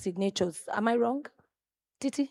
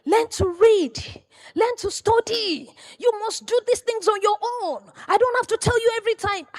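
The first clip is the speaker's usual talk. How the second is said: stern mentor; 13 semitones higher, 6 dB louder than usual. Retold or imitated. Mentor scolding the young apprentice, high and stern. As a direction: scold her like a strict teacher. Mentor lecturing her, retold.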